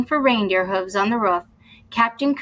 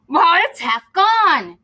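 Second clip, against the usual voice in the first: high, loud, slow cry of shock; dismayed cry; 7 semitones higher, 6 dB louder than usual. Acted. Captain finding his cutlass nibbled, shocked loud cry. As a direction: let it burst out in dismay. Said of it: "cried the captain".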